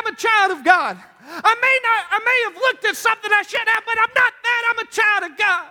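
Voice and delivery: high, impassioned preacher's declaration; preaching at full force. Acted. Preacher proclaiming identity to the church, high and fervent. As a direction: lift it up, testify with fire.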